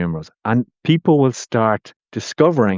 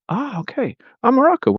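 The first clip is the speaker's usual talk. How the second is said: much higher, over-eager interest; hammed-up curious reaction; mock interest. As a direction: gush a little, as if fascinated. Acted asker reacts with interest.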